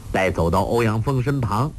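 ordinary delivery

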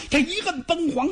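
plain reading voice

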